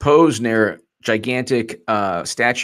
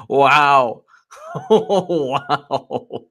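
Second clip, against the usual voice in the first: laughing; 2 semitones higher, much slower than usual. Real, not acted. Laughing at doxer.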